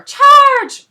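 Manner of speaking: very high, very loud battle shriek; shrieking dinosaur charge. A narrator's reading. Dinosaurs rush the house, shrieking charge. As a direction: scream the charge. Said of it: "dinosaurs yelling".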